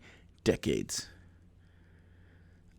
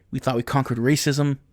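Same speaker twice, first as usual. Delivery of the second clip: normal talking voice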